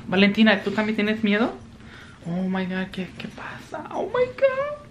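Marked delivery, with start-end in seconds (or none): 2.3-4.8 very soft, breath-only alarm; frightened whisper. Real, not acted. Vlogger reacting to more thunder, frightened whisper. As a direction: whisper as if scared by the noise.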